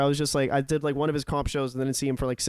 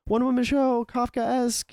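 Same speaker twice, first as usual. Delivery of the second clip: affected flyerer pitch; 9 semitones higher, slower than usual. Retold or imitated. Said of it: "Don't give me, like".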